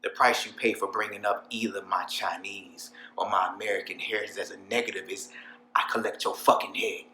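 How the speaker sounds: hushed, contained menace; quiet threat. Acted. Boss warning the room about insults to heritage, quiet menace. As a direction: threaten them under your breath.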